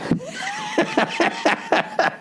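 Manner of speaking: squeaky high laugh; high giggle. Real, not acted. Comic laughing at his own story, high giggle.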